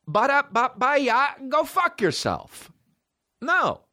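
high, slow, sing-song brush-off; mocking dismissal. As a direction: wave them off with a taunt. Mocking the venue's demand.

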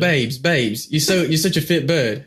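British accent. Shown as a demonstration. Showing a friend how Love Island talks, British accent. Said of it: "with a British accent".

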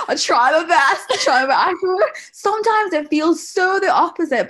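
loud, high, laughing protest; real flustered laughter at the praise. Real, not acted. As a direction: laugh it off, half embarrassed. Embarrassed at being called good mum.

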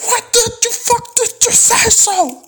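very high, drawn-out mock scream; mock furious outburst. Bandleader blows up at the out-of-tune player, mock screaming. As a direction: scream it in an over-the-top imitation of a raging bandleader.

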